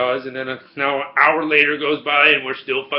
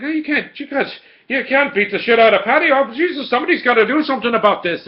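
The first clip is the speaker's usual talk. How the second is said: high, agitated mock Irish voice; fired-up Irish local; 10 semitones higher, 6 dB louder than usual. Irish local objects to Wonder Woman's beating, high and fired up. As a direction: protest in a high-pitched Irish character voice. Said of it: "Irish locals all fired up".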